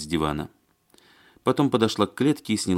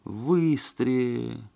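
long, drawn-out moan, higher pitch; groaning; 7 semitones higher, much slower than usual. A narrator's reading. Алексей realising how far the dacha is, groaning. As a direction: groan the words out very slowly. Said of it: "простонал Алексей".

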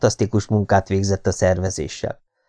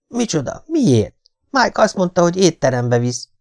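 put-on high, startled child's voice; a little boy who doesn't want to leave; young boy protesting; 9 semitones higher than usual. Son Neten protesting to his mother, high childlike voice.

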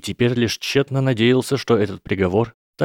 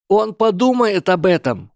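high, loud outburst; exclaiming; 11 semitones higher, 4 dB louder than usual. Acted. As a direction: blurt it out eagerly. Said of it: "воскликнул Фродо".

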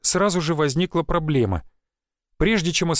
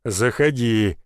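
low-pitched hearty invitation; pleased bass welcome; 4 semitones lower, much slower than usual. A narrator's reading. Sawmill owner inviting the visitor in, pleased bass. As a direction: invite him in with a deep, contented voice. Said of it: "довольным голосом пробасил владелец лесопилки".

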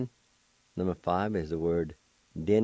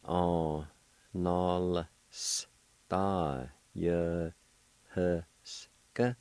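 Onostayahuska stretched very slowly; slow syllable demo; much slower than usual. Shown as a demonstration. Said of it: "It's pronounced like this".

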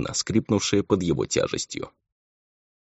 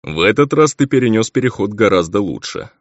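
raised, louder voice of approval; a pleased demon praising the boy; satisfied, projecting voice; 4 semitones higher, 5 dB louder than usual. A narrator's reading. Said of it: "довольно сказал Булфер".

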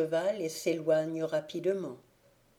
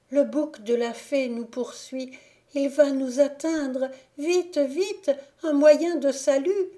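rising from urgent to high, loud panic; fleeing girl crying out in fear; panicked plea; 8 semitones higher, 7 dB louder than usual. Acted. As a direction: beg for rescue as the danger closes in.

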